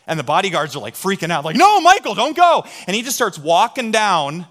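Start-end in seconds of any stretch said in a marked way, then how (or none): 1.5-2.6 high, loud frantic cry; panicked shout. Retold or imitated. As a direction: yell in alarm to stop him. Bodyguards panicking in retold story.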